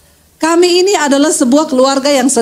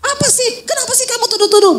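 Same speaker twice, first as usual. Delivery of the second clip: acted defensive anger, pitched up and rushed; angry husband; 10 semitones higher, 3 dB quieter, faster than usual. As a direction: play an annoyed husband snapping back in a high voice. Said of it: "Marah, saudara".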